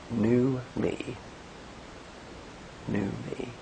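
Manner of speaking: soft, hushed "new me" on a breath; gentle meditative mantra; breathing out words. Shown as a demonstration. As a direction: breathe out, then say it quietly. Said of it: "Take a deep breath and say two words".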